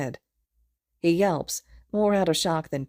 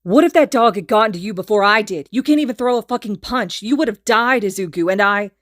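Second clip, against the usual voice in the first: loud, higher angry rant; furious yelling; 5 semitones higher, 9 dB louder than usual. A narrator's reading. Kacchan yells at Izuku who nearly died, enraged. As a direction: yell it at him.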